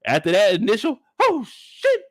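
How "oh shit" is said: very high squeaky startled cry; Mickey Mouse voice. Shown as a demonstration. A friend hears the first fright, a high squeaky cry. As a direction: squeak it out in shock. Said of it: "You sound like Mickey Mouse".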